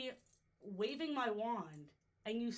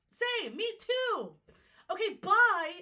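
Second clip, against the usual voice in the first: high, stretched, then a loud bright bye; giddy agreement; 10 semitones higher, 10 dB louder, slower than usual. Retold or imitated. Answering the kid, high and giddy, then a loud bye. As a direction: gush back, then bail fast. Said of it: "I'm like".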